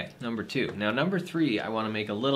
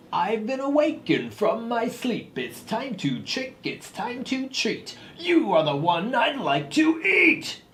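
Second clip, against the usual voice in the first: spooky monster voice; 9 semitones higher, 3 dB louder than usual. Pretend. Recording spooky Halloween song lines.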